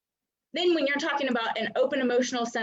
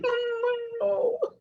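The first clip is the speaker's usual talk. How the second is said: mock crying; 11 semitones higher than usual. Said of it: "we start crying".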